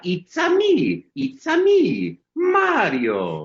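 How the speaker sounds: very high, drawn-out cartoon Italian voice; Mario. Voice actor coaching the host's impression, exuberant Mario. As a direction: sing out each phrase high and slow, then swoop down. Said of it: "Mister Mario himself".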